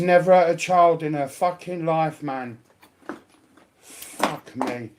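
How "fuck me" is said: ordinary talk, nothing marked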